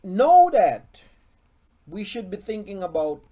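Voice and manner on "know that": emphatic. Real, not acted. Preacher stressing a point.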